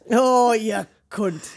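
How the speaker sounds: loud, drawn-out put-on curse; mock cursing. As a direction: stretch it out slowly and loudly, imitating him.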